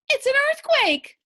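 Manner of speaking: high, loud panicked cry; panicked shout. A narrator's reading. Wife shouting to her husband as the bed falls, panicked shout. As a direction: scream it as the floor gives way. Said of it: "shouted Missus Watson".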